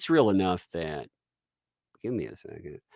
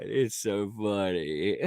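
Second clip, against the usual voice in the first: normal talking voice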